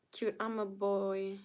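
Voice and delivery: ordinary talk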